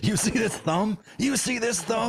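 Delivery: high-pitched, put-on challenge; playacted indignant gator survivor; mock scarred gator handler. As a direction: show off an injury in a hammed-up voice. Joins bit as scarred gator handler.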